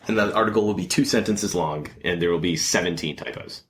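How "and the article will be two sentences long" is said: usual speaking voice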